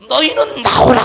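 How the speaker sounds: loud, commanding shout; great cry. Acted. Preacher voicing Jesus calling sinners out, great cry. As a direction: cry it out as a summons. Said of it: "이런 소리처럼 들려진다는 거예요".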